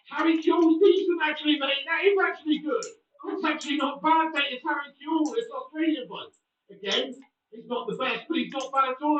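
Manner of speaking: real excitement over a good card; hyped up. Real, not acted. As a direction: talk it up like a big win. Hyped over a good pack pull.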